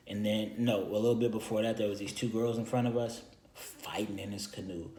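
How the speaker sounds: ordinary delivery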